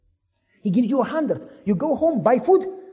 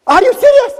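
high, loud disbelieving cry; can't believe the gift; amazed shout; stunned disbelief; 13 semitones higher, 11 dB louder than usual. Acted. Stunned at twenty million.

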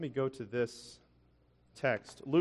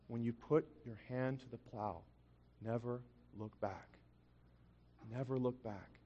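hushed, reflective recollection; soft and low, pausing between phrases; solemn echo; 3 semitones lower, 10 dB quieter than usual. Retold or imitated. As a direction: repeat it like words that stayed with you. Repeats the remembered words solemnly.

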